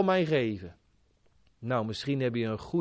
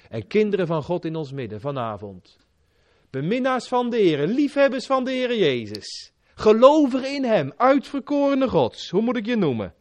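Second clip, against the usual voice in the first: loud, high fervent pulpit preaching; preaching at full force; 7 semitones higher, 5 dB louder than usual. Acted. Preacher pleads with the listeners, fervent loud preaching. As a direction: urge the congregation with rising passion.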